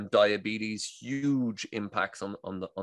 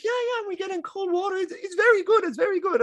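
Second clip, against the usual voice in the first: much higher, imitating a Dutch accent; Dutch lady impression; 4 dB louder than usual. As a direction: mimic a chirpy Dutch woman with a Dutch accent. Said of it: "And they're like".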